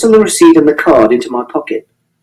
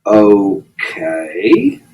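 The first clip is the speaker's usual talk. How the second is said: low, drawn-out okay; really slowly; 8 semitones lower, 3 dB quieter than usual. A narrator's reading. Policeman doubting the boy's story, slow low okay. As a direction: stretch the okay right out. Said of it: "But he said it really slowly".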